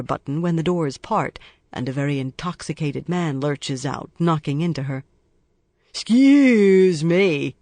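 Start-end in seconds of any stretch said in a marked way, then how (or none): 5.9-7.5 loud, slow, drawn-out excuse me; loud drunk. A narrator's reading. Drunk man lurching out of the elevator, loud. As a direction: bellow it like a drunk. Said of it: "he says loudly".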